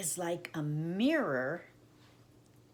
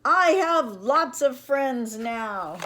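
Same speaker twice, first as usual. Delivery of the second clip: the same shout come back loud and slow; the echo answering across the pond; 7 semitones higher, 12 dB louder, slower than usual. A narrator's reading. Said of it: "a voice answered him".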